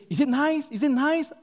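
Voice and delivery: high, insistent hostess voice; eager prompting. Retold or imitated. Lady fishing for praise of her soup, eagerly prompting. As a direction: prod hopefully for a compliment. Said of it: "the lady was prompting him".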